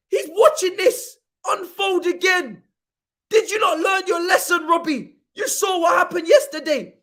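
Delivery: real indignant yelling; outraged shouting. Real, not acted. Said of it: "don't ask me why I'm shouting".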